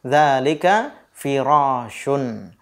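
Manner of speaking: dzalika firashun said slowly, falling at the end; teacher giving the answer, slow. Shown as a demonstration. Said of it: "jawabannya la".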